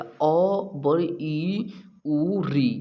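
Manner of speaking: vowels sounded out one by one, slowly; vowel demo. Shown as a demonstration. Teacher sounding out the vowels for students, slow and deliberate. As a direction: spell out each vowel for the class. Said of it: "जैसे आप अ बोलिएगा".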